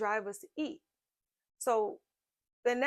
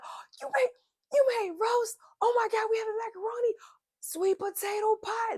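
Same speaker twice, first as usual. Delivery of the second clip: much higher, loud, squealing with delight; family going wild; 13 semitones higher, 6 dB louder than usual. Retold or imitated. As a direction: mimic the kids shrieking with joy over dinner. Family voiced reacting to dinner.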